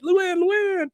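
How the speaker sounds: very high mock holler; imitated high-pitched chasing call; calling after her. Guys call after fleeing niece.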